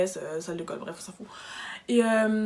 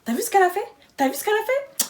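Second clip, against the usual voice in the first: mock whiny supervisor; 11 semitones higher, 3 dB louder than usual. Mocking the supervisor's complaint.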